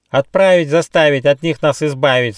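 high, loud squawky bird chant; excited, high-pitched rhyming demand; rattling kookaburra. A narrator's reading. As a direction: jabber it out shrilly like a bird. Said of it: "снова затараторила Кукабура".

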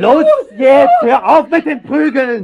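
very high, loud yell; desperate shout. Acted. Lover yelling at the mother to stop beating the child.

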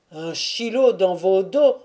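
loud, mildly angry comeback; cursing retort. A narrator's reading. Crowd swearing at the backers of Chilo, cursing retort. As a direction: throw it back as an oath.

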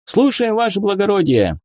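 high, eager military reply; dutiful call, pitched well up; soldiers' smart response. A narrator's reading. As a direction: answer the officer at attention. Soldiers answer officer at attention.

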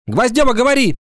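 high-pitched bossy schoolgirl; curt order. A narrator's reading. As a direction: call the next speaker firmly. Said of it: "отрезала Ира Сыркина". (властно, коротко)

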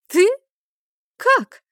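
high, loud startled scoff; snorting disbelief. A narrator's reading. Friend doubting she can help with a dragon, snorting disbelief. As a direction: scoff it out in two sharp bursts. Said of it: "фыркнула подруга".

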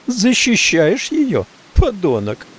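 high, slow, accusing jab; angry accusation. A narrator's reading. Maggie accusing the narrator, high slow jab. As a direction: drag it out in suspicion.

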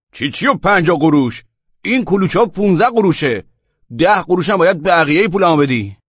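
high, loud indignant outburst; angry young man. A narrator's reading. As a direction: argue hotly over being short-changed. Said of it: "جوان عصبانی شد".

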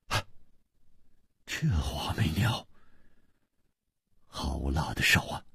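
hushed, breath-heavy exclamation of dismay; long sigh. A narrator's reading. Chu lamenting the killer's ruthless hand, sighing low. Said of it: "长叹道".

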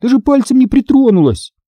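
very high, insistent denial; outraged child's squeal; indignant. A narrator's reading. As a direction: protest the unfair accusation. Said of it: "возмутилась я".

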